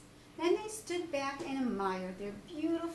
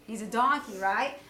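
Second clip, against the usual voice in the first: plain reading voice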